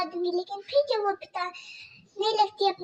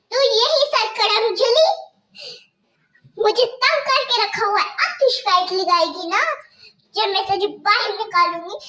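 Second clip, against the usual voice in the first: harsh stepmother scolding; 2 semitones higher, 6 dB louder than usual. Acted. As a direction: bark orders at the girl like a cruel stepmother.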